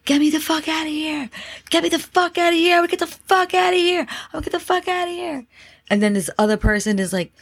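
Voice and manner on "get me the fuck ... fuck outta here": retold scream. Man screams in a stuck elevator, retold mock scream. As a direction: imitate a man frantically screaming to get out. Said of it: "this guy starts screaming".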